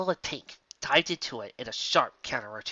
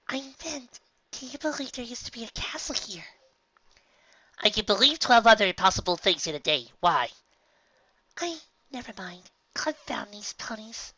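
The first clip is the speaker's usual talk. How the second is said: muttering pony; 9 semitones higher than usual. Acted. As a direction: grumble under your breath, annoyed. Character dialogue in read story.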